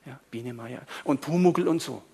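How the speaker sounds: normal talking voice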